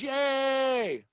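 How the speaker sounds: high, drawn-out mock cheer; cheering crowd. Whole cabin cheers the announced arrival, mock-excited cheer. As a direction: imitate passengers cheering with a long, high yay. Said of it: "Everybody goes".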